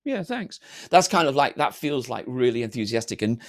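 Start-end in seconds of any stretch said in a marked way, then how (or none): 0.0-0.6 high, soft, half-hearted approval; muted enthusiasm. Acted. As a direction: imitate someone barely managing to sound pleased. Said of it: "height of enthusiasm is when you go".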